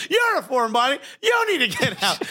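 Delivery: high-pitched mock outrage; indignant comeback. Patient's imagined retort to the foreign doctor, indignant. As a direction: throw it back at her, high and offended.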